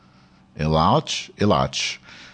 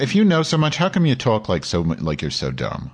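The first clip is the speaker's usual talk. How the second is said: normal talking voice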